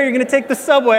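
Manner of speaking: imitating the clerk insisting on the subway; mock New York accent on "You're gonna take the subway"; the clerk's accent. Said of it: "he actually had this accent".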